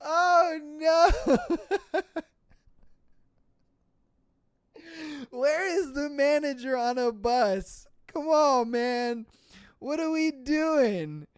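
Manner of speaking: high, helpless wail of amusement; recovering from a laughing fit; laughing exasperation. Real, not acted. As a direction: plead in amused disbelief.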